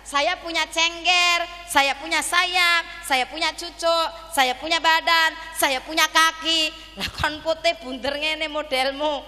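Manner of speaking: high, loud hen listing her features; complaining hen. Acted. Hen comparing herself to her egg, loudly complaining. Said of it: "Jare ayame ngaten".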